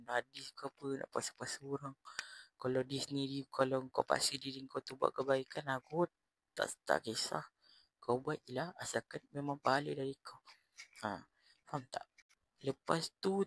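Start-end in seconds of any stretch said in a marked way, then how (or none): none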